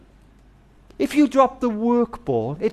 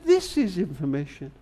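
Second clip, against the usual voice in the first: operator voice; 4 semitones lower, 6 dB quieter than usual. Retold or imitated. The operator answers the boy.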